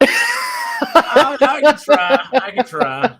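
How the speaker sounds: high, loud, hammed-up British accent; British impression. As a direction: laugh through a shaky British imitation. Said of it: "You gotta work on your British impression".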